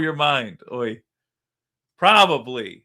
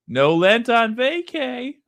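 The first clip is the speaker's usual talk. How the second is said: mock excuse voice; 11 semitones higher, 6 dB louder, slower than usual. Voicing the clip's excuse.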